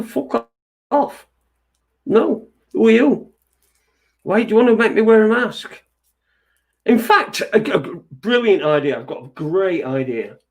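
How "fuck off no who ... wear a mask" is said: mock defiant retort. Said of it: "Just go".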